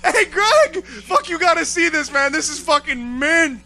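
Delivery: high, loud, laughing guard calling his buddy; mock security guard cackling at footage; gleeful security guard. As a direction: whoop it up over the tape. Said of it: "and went".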